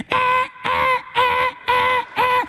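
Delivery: high electronic beeps; alarm beeping. Retold or imitated. Imitating alarm clock.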